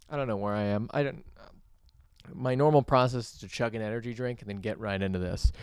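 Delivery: usual speaking voice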